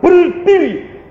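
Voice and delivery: high, loud, drawn-out order; booming divine command. Retold or imitated. God ordering the kneeling believer up, loud and drawn-out. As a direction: call it out slowly with full authority. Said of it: "dia berkata".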